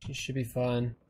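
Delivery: usual speaking voice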